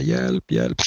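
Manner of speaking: lightly voiced worn-out old man pleading; mock feeble help call; weak cry for help. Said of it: "så han sitter där och bara".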